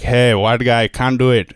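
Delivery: lilting Indian-accented English; light, chatty Indian accent. Shown as a demonstration. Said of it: "the Indian accent is".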